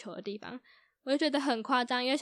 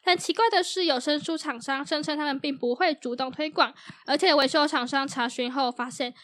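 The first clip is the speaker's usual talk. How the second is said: louder news anchor delivery; news read; 2 semitones higher, 7 dB louder than usual. Acted. Reads a news item aloud. (新闻主播播报腔)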